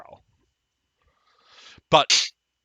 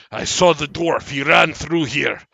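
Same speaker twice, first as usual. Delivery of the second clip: a strange magical man's emphatic, raised voice; strange deranged man; 6 semitones higher, 13 dB louder than usual. Acted. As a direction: proclaim each word loudly and deliberately.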